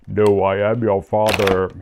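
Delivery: lightly quoting the famous Vader line; Darth Vader quote. Retold or imitated. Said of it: "Darth Vader says".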